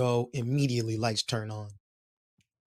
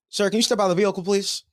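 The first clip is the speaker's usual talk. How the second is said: higher, louder official order; commanding cop; 7 semitones higher, 5 dB louder, faster than usual. Retold or imitated. Cop orders the driver out of the car, loud and curt.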